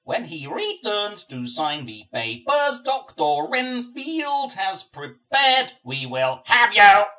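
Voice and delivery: slow, loud, high grating nurse; grating demon voice. Acted. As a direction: grate each word out like a demon nurse. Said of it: "grates the middle sister".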